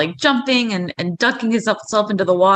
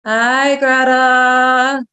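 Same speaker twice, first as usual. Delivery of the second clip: drawn-out, droning reluctant hello; sheepish greeting; 3 semitones higher, 5 dB louder, much slower than usual. Acted. Clay surfaces from the water before Greta, sheepish. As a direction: stretch the hello out sheepishly, on one held note. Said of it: "sheepishly pops his head up".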